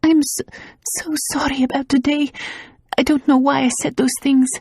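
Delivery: high and shaky with tears; a woman tearfully apologising to her lover; tearful apology. A narrator's reading.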